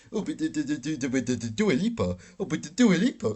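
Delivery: high, loud mocking fangirl gush; sneering fan impression. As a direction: squeal it like a starstruck fan. Mocking fans gushing over [name].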